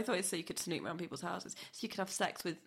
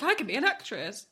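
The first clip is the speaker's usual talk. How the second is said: louder, higher boast of a would-be actress; proudly mock your younger self, pitched up; retold self-delight; 6 semitones higher, 6 dB louder than usual. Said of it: "And I was like".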